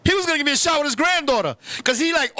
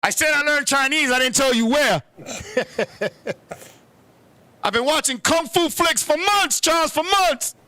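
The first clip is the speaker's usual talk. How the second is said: ordinary talk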